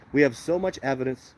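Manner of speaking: ordinary delivery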